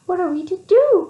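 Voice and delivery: high, loud frightened cry; cold and scared. Acted. Mice freezing in the snow, high frightened cry. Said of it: "cried the mice through chattering teeth".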